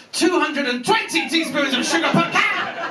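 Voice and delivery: high mock proclamation; government decree. Government lays down the sugar limit.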